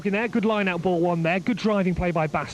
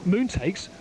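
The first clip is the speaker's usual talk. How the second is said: usual speaking voice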